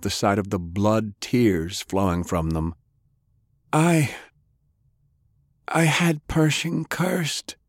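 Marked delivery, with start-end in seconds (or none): 3.7-7.6 put-on slow, high, halting old man; dying confession. A narrator's reading.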